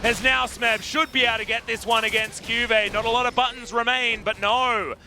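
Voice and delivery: high, driving esports commentary; excited play-by-play. Real, not acted. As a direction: call the team fight with rising excitement, stretching key words. Live esports play-by-play at fight peak.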